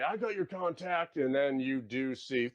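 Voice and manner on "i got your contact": higher put-on townsperson voice; high-pitched mock defiance; hostile radio townsperson. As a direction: retort as a local over the radio. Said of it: "you do hear one of them say".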